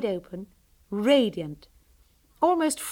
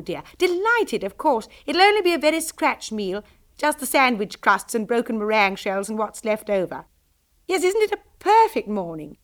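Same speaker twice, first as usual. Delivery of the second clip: put-on high, loud and gushing, a happy young girl; bubbly girl on the phone; 5 semitones higher, 8 dB louder than usual. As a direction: chirp down the line to her friend. Laura chatting on the telephone.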